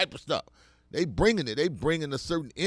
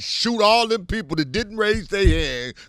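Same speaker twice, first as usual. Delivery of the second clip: louder and higher, pressing the order; loud militant quote; 5 semitones higher, 8 dB louder than usual. Retold or imitated. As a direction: shout it like the brother calling the room out. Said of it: "He said".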